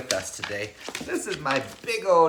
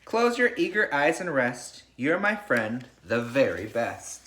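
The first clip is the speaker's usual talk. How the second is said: put-on reading to children. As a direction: lull the class like a bedtime story. Reads picture book to class.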